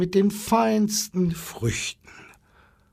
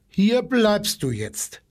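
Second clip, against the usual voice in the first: slow, higher-pitched firm order; commanding; 6 semitones higher, slower than usual. A narrator's reading. Zookeeper ordering the monkey to stay home, commanding. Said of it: "befahl er".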